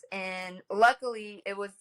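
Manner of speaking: ordinary delivery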